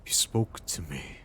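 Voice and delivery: halting, quiet murmur with long pauses; hushed awe. Acted. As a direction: speak softly, as if to someone only you can hear. Stunned, murmuring to an unseen speaker.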